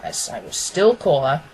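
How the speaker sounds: usual speaking voice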